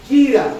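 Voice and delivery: "gira" with the accent on the last A; stress on final syllable. Shown as a demonstration. Preacher teaching the Hebrew word, "gira" stressed on final A. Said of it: "con acento en la".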